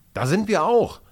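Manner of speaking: loud, high burst of surprise; startled exclamation, pitch jumping up; astonished. A narrator's reading. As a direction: blurt it out, amazed. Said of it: "entfährt es Justin verblüfft".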